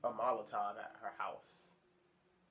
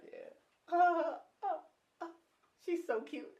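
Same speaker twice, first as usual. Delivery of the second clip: swooning heart. Retold or imitated. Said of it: "My heart be all like".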